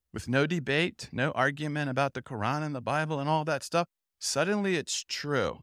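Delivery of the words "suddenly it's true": ordinary talk, nothing marked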